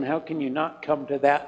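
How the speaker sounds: ordinary talk, nothing marked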